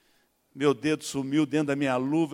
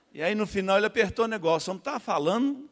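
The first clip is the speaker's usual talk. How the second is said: normal talking voice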